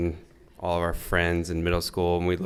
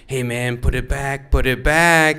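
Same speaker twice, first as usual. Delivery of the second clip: urgent warning; 5 semitones higher, 4 dB louder than usual. Retold or imitated. Man runs up warning the boys.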